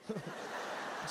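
quiet breathy chuckle; soft unvoiced laugh. Real, not acted. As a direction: laugh softly at the joke. Laughs at own joke. (risadinha baixa sem voz)

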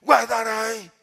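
loud, sharp stern warning; threatening God. Shown as a demonstration. Preacher mimics God seen as religion, loud threatening order. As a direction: bark it as a menacing command. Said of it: "Deus assim".

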